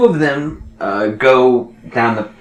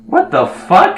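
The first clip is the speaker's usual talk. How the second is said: high, loud startled shriek; shocked ant blurting out a high curse; 4 dB louder, slower than usual. Acted. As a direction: jump in shock at the intruder. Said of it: "the ant looks at you and goes".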